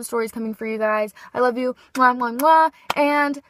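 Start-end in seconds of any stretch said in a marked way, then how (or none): 1.9-2.7 loud smacking air kisses; blown kisses. Real, not acted. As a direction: blow kisses to the viewers. Blowing kisses at end.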